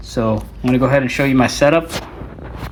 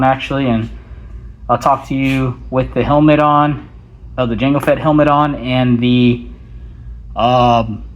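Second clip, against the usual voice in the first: normal talking voice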